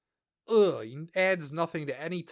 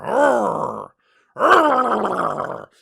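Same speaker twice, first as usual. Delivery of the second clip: loud, higher angry growl like a cartoon beast; mock angry growl; 7 semitones higher, 10 dB louder than usual. Growling after the announcement.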